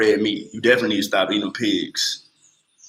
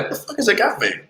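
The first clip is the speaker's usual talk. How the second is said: high surprised squeal; 10 semitones higher, 3 dB quieter than usual. Retold or imitated. After tasting agave, amazed high exclaim. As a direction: pitch jumps way up in shock. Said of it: "I was like".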